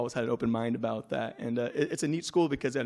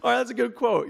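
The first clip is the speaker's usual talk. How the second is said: mock eager agreement; 10 semitones higher, 4 dB louder than usual. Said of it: "So I said".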